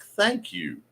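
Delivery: ordinary talk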